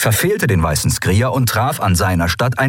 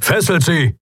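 barked order; 9 semitones higher than usual. Acted. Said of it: "bellte Kobek".

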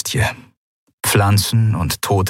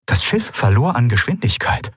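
ordinary delivery, nothing marked

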